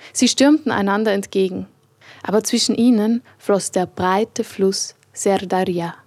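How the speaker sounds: ordinary delivery, nothing marked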